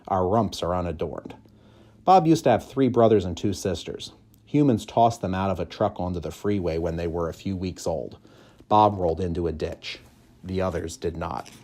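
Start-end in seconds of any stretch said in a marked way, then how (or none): none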